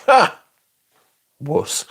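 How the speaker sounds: gloating jab at a crying stranger; mock taunt. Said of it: "just to say".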